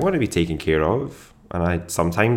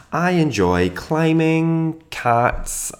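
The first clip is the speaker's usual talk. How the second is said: high, slow, breathy bragging; a playful dating-ad brag; mock dating profile voice; 10 semitones higher, much slower than usual. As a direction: put on a pitched-up profile read.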